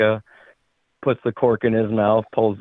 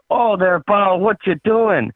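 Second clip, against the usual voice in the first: put-on higher-pitched, alarmed Canadian accent; 9 semitones higher, 4 dB louder than usual. Canadian freaks out at his drinking pal, Canadian accent. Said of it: "that's a pretty spot-on Canadian".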